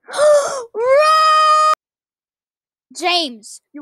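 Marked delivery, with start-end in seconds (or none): 0.1-1.7 gasp and cry. Real, not acted. Gasps on seeing friend.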